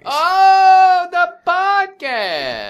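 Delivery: very high, loud, slow gushing oh; mock excitement. Real, not acted. Cohost hyping the live podcast, high exaggerated gush. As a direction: gush over it like a game show host.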